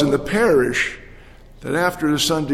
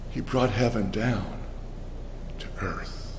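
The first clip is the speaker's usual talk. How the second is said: whispering; 7 semitones lower, 11 dB quieter than usual. Retold or imitated. Punchline of the story, hushed.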